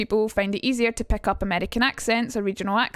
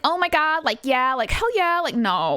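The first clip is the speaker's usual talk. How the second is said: higher-pitched, hammed-up Valley Girl; exaggerated California teen-girl accent; 4 semitones higher than usual. Shown as a demonstration. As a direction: gush and swoop like a Valley Girl. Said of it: "gotta see if I've got a Valley Girl".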